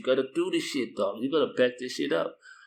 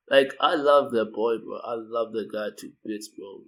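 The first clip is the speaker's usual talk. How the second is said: ordinary delivery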